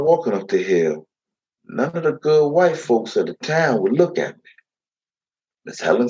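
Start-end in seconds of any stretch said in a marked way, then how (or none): none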